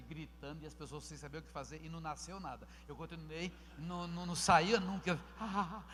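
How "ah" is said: normal talking voice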